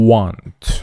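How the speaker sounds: "want" with lips pushed out into a long rounded W. Shown as a demonstration. Teacher showing learners how English W is formed, rounded-lip "want". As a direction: start "want" on pursed lips, holding the w glide before the vowel.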